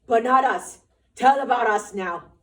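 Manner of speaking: loud, eager prompting. Acted. Lenny eagerly prompting George's story, loud. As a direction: push him on loudly.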